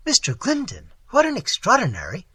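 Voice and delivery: high, surprised outburst; startled exclamation. A narrator's reading. Wife startled by an unexpected late visitor, exclaiming. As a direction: blurt it out in astonishment. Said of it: "exclaimed Missus Mervale".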